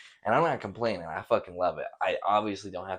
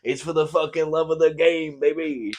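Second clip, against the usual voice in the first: louder, higher, all-out hyped shout; repeating the line to the coworker, louder and fully hyped; bigger hype; 8 semitones higher, 8 dB louder than usual. Retold or imitated. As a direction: sell it even harder, full swagger. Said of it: "That's what I told her".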